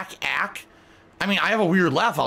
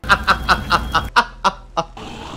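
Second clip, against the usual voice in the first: airy laugh; 3 semitones higher, 4 dB louder than usual. Real, not acted. Laughs after ack.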